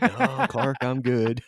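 high, loud hammed-up Eddie; Eddie impression. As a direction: chirp it back as clueless Eddie.

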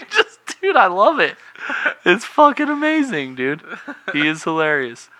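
high, loud, laughing through words. Real, not acted. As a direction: gush while cracking up. Cracking up over the yell.

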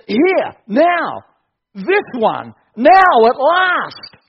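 loud, high and slowed, savoring each word; elated proclamation. Retold or imitated. Adam at last finding his match, slow loud elated cry. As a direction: announce it like a long-awaited prize. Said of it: "he just says".

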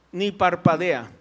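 normal talking voice

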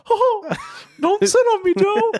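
very high, loud cartoon squeak; Mickey Mouse voice. Brother begs not to be sat on, Mickey Mouse voice. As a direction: plead as a squeaky cartoon boy. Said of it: "I like the Mickey Mouse".

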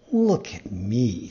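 low, slow fox voice; sly fox. A narrator's reading. Fox offers himself as warning.

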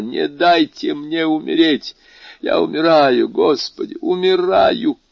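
high, loud, desperate plea; crying out. A narrator's reading. Dying servant begging the doctor, crying out. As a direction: cry out as if dying, pitch high. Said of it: "кричал Баруа".

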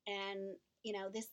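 ordinary talk

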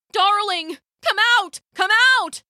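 husband calling anxiously into the brass pot. Acted. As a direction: call his wife out of the pot. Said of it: "he called".

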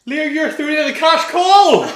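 high, loud game-show cry; mock prize-call announcer.

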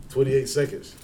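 ordinary delivery

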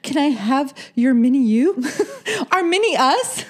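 lovestruck daughter. Pretend. Joking as the other woman's daughter someday, hammed-up lovestruck.

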